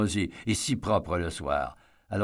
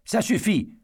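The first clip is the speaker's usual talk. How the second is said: shout; 11 semitones higher than usual. A narrator's reading. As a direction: snap back at full force. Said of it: "hurla Eddie".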